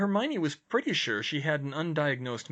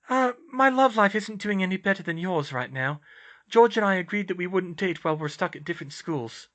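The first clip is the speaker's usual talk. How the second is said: higher-pitched character voice, earnest; raised, sincere dialogue voice; earnest young heroine; 8 semitones higher, 5 dB louder than usual. Acted. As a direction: voice the concerned friend, pitched up.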